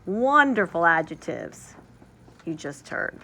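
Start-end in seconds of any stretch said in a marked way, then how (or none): none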